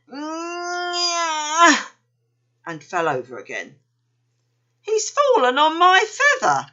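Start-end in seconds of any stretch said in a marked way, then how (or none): 0.1-1.9 high strained nyah, eyes shut tight; straining grunt. A narrator's reading. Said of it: "and went". 4.8-6.7 put-on high, louder child's complaint; upset young joey. A narrator's reading. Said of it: "said Jumper".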